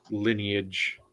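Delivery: ordinary talk, nothing marked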